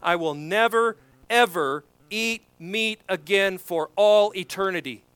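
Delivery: "never, ever" punched as separate, weighty words; emphatic vow. Retold or imitated. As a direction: hammer "never", "ever" and "all eternity". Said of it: "he relays this concept of sacrificial love emphatically".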